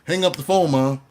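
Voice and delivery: urgent shout. Retold or imitated. Son telling mom to hang up the phone, loud.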